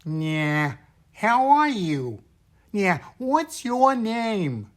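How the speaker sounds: high, loud, drawn-out nasal cartoon voice; nasal puppet voice. Shown as a demonstration. Teacher demonstrating a sentence for puppeteers, very nasal cartoon voice. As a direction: push it through the nose, slow and high. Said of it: "let's try saying a sentence in that voice".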